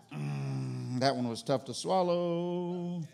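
low, soft, slow murmur; mock sobered reaction. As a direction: let it sink in heavily, drawn out. Reacting to a hard saying.

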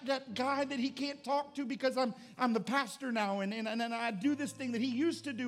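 high, loud, urgent sermon delivery; climbing fervent pulpit voice; impassioned preaching. Acted. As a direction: preach it with everything, pleading. Preaching at full force.

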